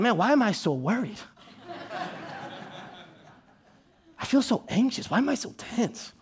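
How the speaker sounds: hushed, worried self-talk at bedtime; soft fretting under the breath; anxious murmur. Retold or imitated. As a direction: mutter it to yourself, barely audible.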